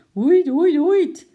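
high, loud repeated hoots; monkey screech. A narrator's reading. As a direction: hoot like an excited ape. Narrator imitates the monkey's cries. (hoge, luide apenkreet)

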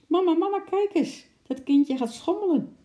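put-on very high, excited child's call; delighted little girl. A narrator's reading. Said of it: "riep het kleine meisje opgetogen". (opgetogen hoog meisjesstemmetje)

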